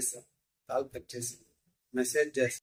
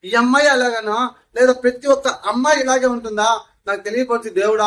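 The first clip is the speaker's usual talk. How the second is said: yelling at God; 6 semitones higher, 7 dB louder than usual. Retold or imitated. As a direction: shout your despair up at the sky. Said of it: "గట్టిగా అరిచాను".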